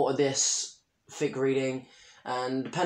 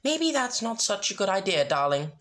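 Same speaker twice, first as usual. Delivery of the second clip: higher, prim ladylike voice; Rarity voice; 7 semitones higher than usual. Acted. Rarity gently advising her friends, in a lightly raised prim voice. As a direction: speak as a refined fashionista.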